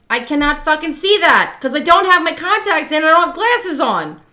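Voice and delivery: loud, fast, high-pitched exasperated rant; exasperated retort. Retold or imitated. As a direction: fire back in mock outrage at a partner. Acted exasperated retort to partner.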